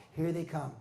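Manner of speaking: usual speaking voice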